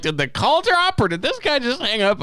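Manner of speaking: very high, incredulous question; mock bafflement. Caller retelling his confusion over a dropped call, mock-baffled. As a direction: squeak it out in puzzled disbelief.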